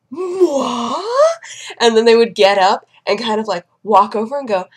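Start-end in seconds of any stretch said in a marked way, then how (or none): 0.1-1.4 high, drawn-out rising "what?"; exaggerated astonishment. Patient hears own number called, hammed-up surprise. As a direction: act amazed, voice swooping up. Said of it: "they looked around like".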